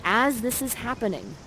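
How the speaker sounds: normal talking voice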